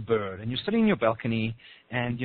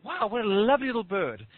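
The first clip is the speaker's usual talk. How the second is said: higher-pitched wonder, lightly put on; mock-dreamy delight, pitched up; acted admiration; 7 semitones higher than usual. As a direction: marvel at a tiny creature. Admiring the bird, acted thought.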